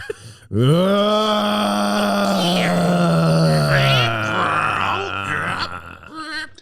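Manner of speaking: long high villain laugh; maniacal villain laugh. Acted. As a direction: cackle as he powers up. Powering up after taunt.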